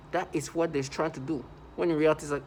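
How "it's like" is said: normal talking voice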